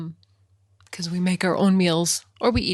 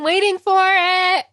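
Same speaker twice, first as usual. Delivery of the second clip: raised, high, drawn-out exaggeration; mock impatience; 10 semitones higher, 10 dB louder, slower than usual. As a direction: playfully blow up mom's eager waiting. Playing up mom's eager waiting.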